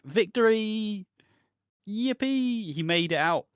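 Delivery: high, squealing victory cheer; gleeful cheer. Retold or imitated. Harry cheering as he escapes the debt, high squeal. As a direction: whoop it up like a kid who won. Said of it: "So Harry's like".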